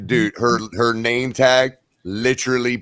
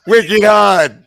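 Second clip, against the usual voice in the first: "Wicked odd" in a big Boston accent, much higher and louder; real Bostonian; 10 semitones higher, 10 dB louder than usual. Shown as a demonstration. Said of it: "Say it like a real Bostonian".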